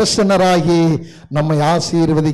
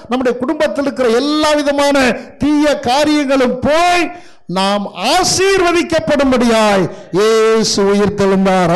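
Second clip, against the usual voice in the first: raised, ringing preacher's refrain; fervent preaching; 5 semitones higher than usual. Real, not acted. Preacher proclaiming why Jesus rose, fervent preaching. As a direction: preach it as a rising chant of promises.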